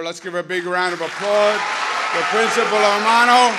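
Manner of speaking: loud, rising shout over applause; rallying applause. Real, not acted. Speaker calls on students to applaud their principal, rallying shout. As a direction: call out the name to a cheering crowd.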